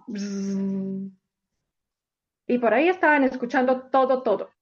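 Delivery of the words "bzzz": low, soft buzzing like a little bee. Acted. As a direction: buzz it out like a bee. Abejita buzzing sound.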